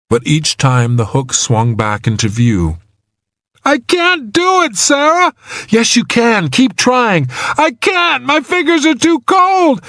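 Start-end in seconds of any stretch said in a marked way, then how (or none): 3.6-5.3 very high, despairing whine; desperate. A narrator's reading. Trapped man tells rescuer he can't do it, desperate. 5.7-7.3 high, insistent urging; urgent encouragement. A narrator's reading. Rescuer pushes trapped man to keep trying, urgent. 7.6-9.8 very high, despairing whine; desperate. A narrator's reading. Trapped man tells rescuer he can't do it, desperate.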